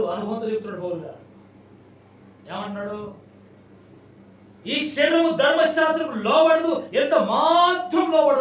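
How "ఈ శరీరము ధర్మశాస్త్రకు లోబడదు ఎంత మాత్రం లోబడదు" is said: slow, high, loud proclamation; Paul's words shouted. Retold or imitated. Preacher shouting Paul's words to the congregation, slow and high. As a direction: thunder out the apostle's verdict word by word. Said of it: "పౌలు గారు అంటున్నాడు".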